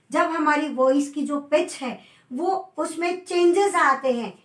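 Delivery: ordinary talk, nothing marked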